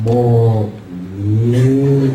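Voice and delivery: very low, drawn-out ngongo voice; nasal-speech customer. Acted. Ngongo customer repeating the order, low nasal speech. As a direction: stretch the garbled word out low. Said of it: "ngongo din".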